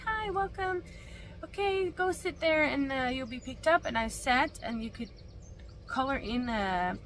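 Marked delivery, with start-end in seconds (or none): 0.0-3.8 high, bright-toned friendly greeting; cheerful receptionist. Retold or imitated. Clinic staff greeting her on arrival, high friendly voice. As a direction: chirp it like a front-desk welcome. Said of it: "they were like".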